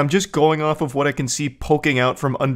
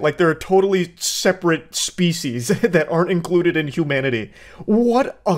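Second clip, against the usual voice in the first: ordinary talk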